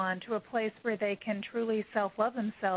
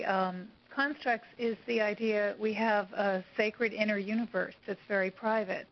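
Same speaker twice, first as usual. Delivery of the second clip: ordinary talk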